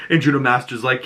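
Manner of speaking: usual speaking voice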